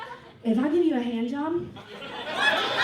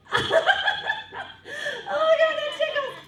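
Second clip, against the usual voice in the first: squealing friend; 12 semitones higher than usual. Retold or imitated. Friend giggling in the dark.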